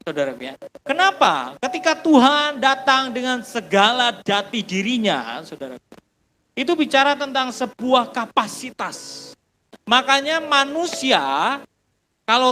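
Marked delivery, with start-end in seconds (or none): none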